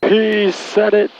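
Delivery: drawn-out, high-pitched mock thrill; playful excitement. Co-pilot reacts to the controller saying it, playfully excited. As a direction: stretch it out high, teasing in delight.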